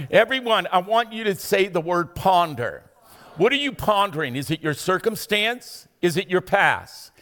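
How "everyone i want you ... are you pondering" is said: normal talking voice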